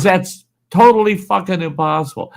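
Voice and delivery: high, emphatic retold protest; imitated outraged disbelief. Biker rejecting the Chihuahua claim, imitated high disbelief.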